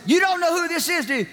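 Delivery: high, quick mimicked woman's voice; high-pitched insistent mimicry; challenging caller. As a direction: imitate a woman pressing someone on the phone. Imitated caller challenges the father.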